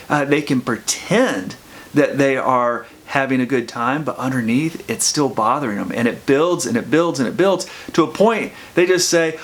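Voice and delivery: normal talking voice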